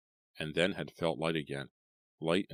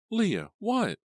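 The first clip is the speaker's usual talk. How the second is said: alarmed mother; 6 dB louder than usual. Acted. Mother alarmed at screaming daughter.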